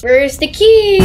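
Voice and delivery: playful put-on high voice; silly talking voice. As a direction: call it out in a goofy high voice. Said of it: "Why do we talk like this?".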